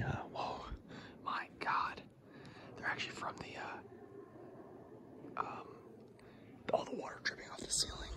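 hushed awed cave whisper. Real, not acted. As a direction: whisper softly, full of wonder.

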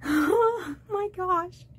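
slow, choked laugh-cry; laughing through tears. Real, not acted. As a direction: let it break between laughing and crying. Overwhelmed by the painted birds.

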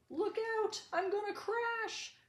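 panicked cry. Acted. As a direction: scream it as the plane drops. Plane plunging down.

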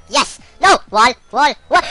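short, urgent yelled calls; frantic shouting. Real, not acted. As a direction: shout warnings to yourself mid-game. Panicked calls during play.

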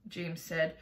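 ordinary delivery

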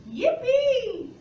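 squealed with joy; excited yippee. Real, not acted. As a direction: burst out happily. Child asked to help.

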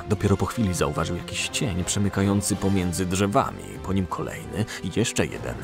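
ordinary delivery

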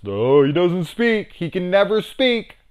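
high, loud, whiny sneer; hammed-up pedantic outrage; mocking purists. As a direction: parody the indignant fans. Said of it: "They're just".

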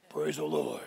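very low, soft rumble; a stone praising from underground; rumbling rock voice. Pretend. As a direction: play a deep talking rock. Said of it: "Big rocks and boulders".

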